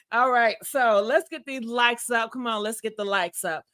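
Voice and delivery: normal talking voice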